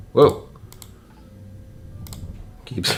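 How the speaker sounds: usual speaking voice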